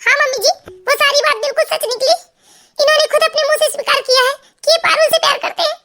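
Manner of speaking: high-pitched weeping-wife voice; acted tearfulness. A narrator's reading. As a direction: complain tearfully to your mother-in-law, pitched high. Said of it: "वो रोते हुए अपनी सास के पास चली जाती है". (रोती बहू का अभिनय, ऊँचे सुर में)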